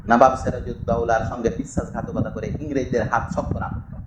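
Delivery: ordinary talk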